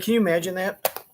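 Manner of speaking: normal talking voice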